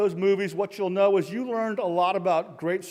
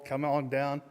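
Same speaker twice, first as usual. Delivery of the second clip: ordinary delivery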